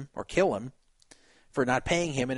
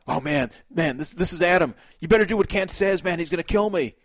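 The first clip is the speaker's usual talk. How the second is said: mock panic; 4 dB louder than usual. Acted panicked phone call as Meyer.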